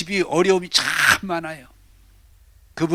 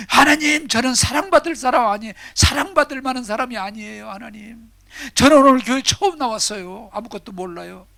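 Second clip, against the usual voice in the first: high, loud pleading newcomer; pleading woman; 6 semitones higher, 3 dB louder than usual. Acted. The woman on her first day pleading with God, high and loud. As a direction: beg God as a first-timer. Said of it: "본인이".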